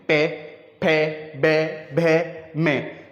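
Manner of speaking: पे फे बे भे में said one by one, lips pressed together; teacher demonstrating पे फे बे भे में for the class; sounding out lip syllables. Shown as a demonstration. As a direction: close the lips firmly on each of पे फे बे भे में. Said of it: "अब पे को आप बोल के देखें".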